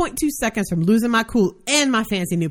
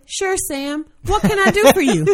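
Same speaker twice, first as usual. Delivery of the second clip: high, over-polite office voice; high, lifted customer-service sweetness; fake helpfulness; 7 semitones higher, 5 dB louder than usual. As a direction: offer help with mock innocence. Acted fake-helpful reply to coworker.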